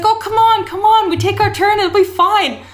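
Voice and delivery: put-on pushy British drunk begging; British accent. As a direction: coax like a drunk British mate. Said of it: "this British accent".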